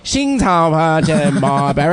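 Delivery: slow mock-Chinese announcement; drawn-out, hammed-up foreign declaration; imitated translator. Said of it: "a translator that's like".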